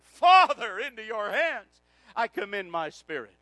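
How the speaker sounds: booming "Father," then easing off; preacher re-enacting a final loud prayer; loud cry. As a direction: shout the first word, then settle. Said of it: "he cried with a loud voice".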